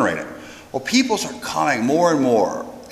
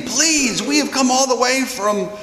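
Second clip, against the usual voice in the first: high-pitched, imploring voice; beg, pitched right up; imitated pleading; 10 semitones higher than usual. Pilgrims beg the crowd after a long journey, pleading. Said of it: "there are certain ones that begin pleading".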